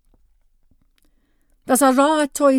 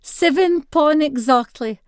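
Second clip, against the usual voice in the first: excited shout; 6 semitones higher, 3 dB louder than usual. Acted. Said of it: "Granny shouts the news".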